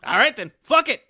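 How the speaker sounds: rising to a high, loud, proud cheer; hammed-up proud father, building to a shout; giddy proud dad. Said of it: "So I was like".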